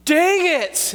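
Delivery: high sharp cry of realization; sudden dismay. Retold or imitated. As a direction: blurt it as the loss hits you. Friend realizes he forgot food.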